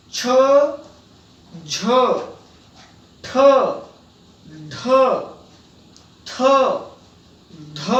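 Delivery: each letter said slowly with a strong puff of breath; clear, breathy Hindi consonant drill; aspirated letters demo. Shown as a demonstration. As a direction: push extra air out on every letter. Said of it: "इनकी तरफ ध्यान दीजिए".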